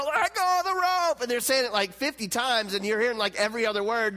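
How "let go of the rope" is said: high, distant call as if muffled by water; much higher, faraway mock yell; heard through water. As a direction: shout it from far off, underwater. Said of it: "you're hearing them through the water".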